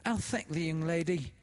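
high, loud, fawning announcement; little creature. A narrator's reading. The little woman announcing Laura to the grieving widow, fawning. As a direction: announce her, falsely sweet. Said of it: "said the other".

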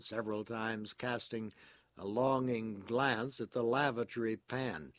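plain reading voice

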